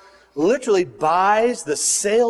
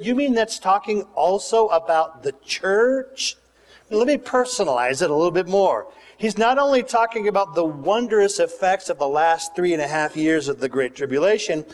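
ordinary delivery

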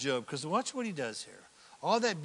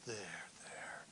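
unvoiced soothing whisper; mock consoling whisper; tempting whisper; 11 semitones lower, 18 dB quieter than usual. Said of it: "You ever hear that voice?".

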